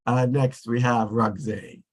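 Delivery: usual speaking voice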